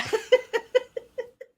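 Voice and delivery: high, helpless giggle; real amused laughter. Real, not acted. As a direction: laugh at your own joke. Laughs at own pot joke.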